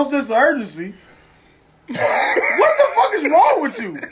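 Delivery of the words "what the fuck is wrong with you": pitched up, slow, exasperated scolding; exasperated rant. Retold or imitated. Scolding careless shoppers in the store, exasperated and high. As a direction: wail at the careless parents.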